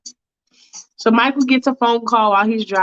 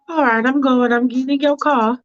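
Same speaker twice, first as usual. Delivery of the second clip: higher, prim and hesitant; a fussy woman hedging politely; Faith's fussy voice; 5 semitones higher than usual. Acted. Faith hesitating to the driver, prim and higher.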